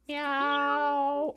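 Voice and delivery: high, long drawn-out mew; kitty meow. Acted. Kitty refuses to say ah, long high meow. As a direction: stretch a reluctant kitten's meow. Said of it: "Okay, kitty".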